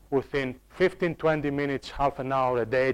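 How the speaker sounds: usual speaking voice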